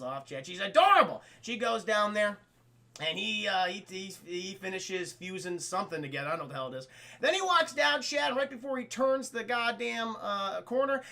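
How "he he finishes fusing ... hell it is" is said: usual speaking voice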